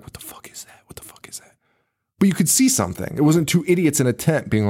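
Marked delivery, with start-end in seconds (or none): 0.0-1.5 very soft scared whisper. Retold or imitated. Retelling characters in the dark woods, whispering. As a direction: hush it as if hiding in the dark. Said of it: "sitting there whispering and being like".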